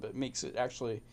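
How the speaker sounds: normal talking voice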